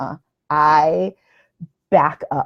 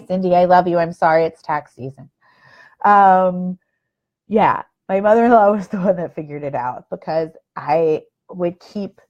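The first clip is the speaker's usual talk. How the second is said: ordinary delivery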